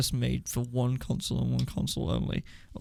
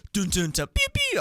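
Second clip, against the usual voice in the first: mock gun sounds; 7 semitones higher, 4 dB louder than usual. Mimicking game sound effects.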